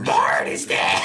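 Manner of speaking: crying Marge voice. Pretend. Simpsons voice bit.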